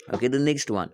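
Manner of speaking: ordinary talk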